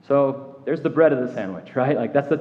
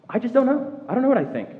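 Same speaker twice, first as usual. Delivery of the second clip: rising doubt; 11 semitones higher than usual. Retold or imitated. Doubting churchgoer repeating the admission, higher and rising, bewildered. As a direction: pitch jumps higher in open bewilderment.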